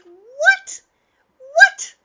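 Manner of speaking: high, loud cry of What?; shocked disbelief. Retold or imitated. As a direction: shriek it in disbelief. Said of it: "And I'm like".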